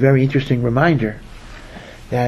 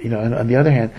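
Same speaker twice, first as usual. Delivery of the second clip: ordinary talk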